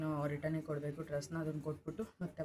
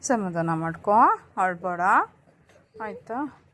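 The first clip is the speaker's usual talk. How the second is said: loud, repeated comforting; 3 semitones higher, 14 dB louder than usual. Real, not acted. Soothing her crying baby.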